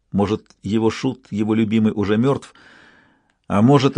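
usual speaking voice

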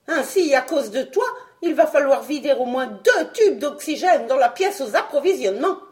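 remontrance plus haute et plus forte, début lent; sermon sévère. Acted. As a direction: faire la leçon avec autorité. Doctor voice. (le savant sermonne Fred, ferme et fâché)

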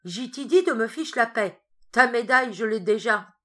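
loud furious outburst; a patient shouting at the doctors, furious; angry shout. Acted. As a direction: hurl the words at them.

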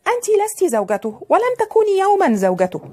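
put-on high-pitched, defiant young rival. A narrator's reading. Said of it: "وقالت في تحدي".

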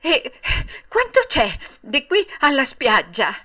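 worried, halting eagerness; anxious, breathless. A narrator's reading. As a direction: ask with panting urgency. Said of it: "domandò Pinocchio con ansia affannosa".

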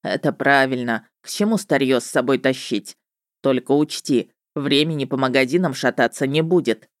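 низкий голос ведьмака; говори пониже, как ведьмак. A narrator's reading. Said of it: "вклинился в мои мысли голос ведьмака". (низкий ведьмачий голос)

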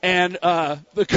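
ordinary talk, nothing marked

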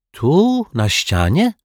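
high-pitched, astonished question; puzzled little girl, taken aback; surprised. A narrator's reading. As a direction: ask in disbelief, pitch leaping up. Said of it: "zapytała zdziwiona Zuzia".

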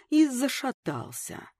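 ordinary delivery, nothing marked

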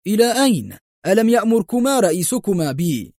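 high-pitched taunting questions; mocking call. A narrator's reading. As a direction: call after them derisively. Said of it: "فهتف ساخرا".

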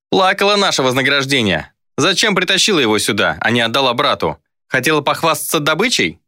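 high-pitched, taunting complaint; cocky young sorcerer. Acted. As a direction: needle her with pointed questions.

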